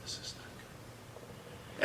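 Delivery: soft, whispered plea; gently. Retold or imitated. Husband gently calming his angry wife, whispered. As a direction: whisper it to calm her down. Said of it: "So I gently said".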